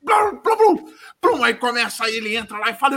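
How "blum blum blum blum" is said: much higher mouth-made gunfire sounds; gunshot noises. Retold or imitated. Retelling John Wick's killing spree, mouth gunfire. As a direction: pop off shots with your mouth.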